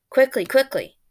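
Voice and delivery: put-on urgently hurrying the girl along. A narrator's reading. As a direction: rush her into the circle. Old man hurrying her along.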